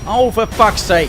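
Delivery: loud, slow exasperated yell; furious shout. Real, not acted. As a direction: bellow in frustration. Exasperated outburst.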